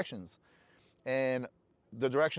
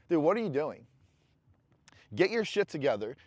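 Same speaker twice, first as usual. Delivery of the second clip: ordinary talk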